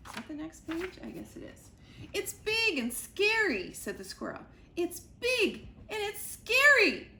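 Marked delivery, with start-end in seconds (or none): 2.1-3.7 high, louder squirrel voice; squeaky frightened squirrel; panicked squirrel. Acted. Squirrel describing the monster to Sugar, high and scared. 4.8-7.1 high, loud, slow frightened squirrel; frantic squirrel. Acted. Squirrel insisting to Sugar, loud and frantic.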